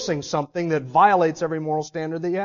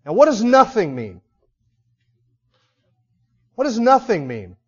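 ordinary talk, nothing marked

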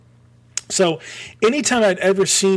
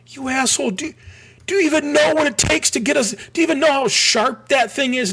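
mock angry dad; 10 semitones higher than usual. Dad angry at son's purchase.

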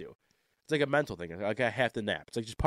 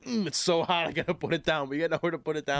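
high-pitched, play-acted distress; mock panicked strain; 4 semitones higher than usual. Carrier stuck with a hot pan, mock panic. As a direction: act like the dish is burning you. Acts out carrying hot dish.

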